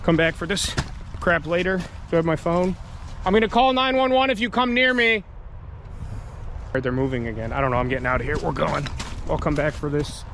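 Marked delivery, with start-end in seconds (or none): 3.2-5.2 calling out. Real, not acted. Threatening the lurker. 5.2-6.8 whispering. Real, not acted. Whispered aside while hiding.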